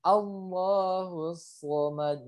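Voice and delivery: slow recitation, "somad" with heavy emphatic S; thick sod. Shown as a demonstration. Teacher models the verse, slow with thick "somad". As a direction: draw out "Allahu", thicken the S of "somad". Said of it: "tapi dia tebal".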